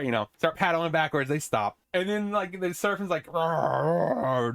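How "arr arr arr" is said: drawn-out imitated monster growl; serpent roar. Imitating the sea serpent rising at the boat, playful roar. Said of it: "doing his serpent thing".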